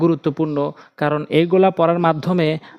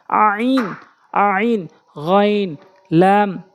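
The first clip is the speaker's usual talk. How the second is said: slow, drawn-out letter names for learners; careful tajweed letter drill; Quran letter recitation; 3 semitones higher, much slower than usual. Shown as a demonstration. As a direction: pronounce each Arabic letter carefully.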